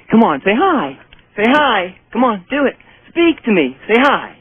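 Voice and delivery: coaxing the dog. Real, not acted. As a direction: keep urging the dog gently.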